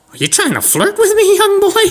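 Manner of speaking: high falsetto granny voice; very high mock old lady. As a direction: squeal it as a hammed-up old woman. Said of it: "looks at this old lady".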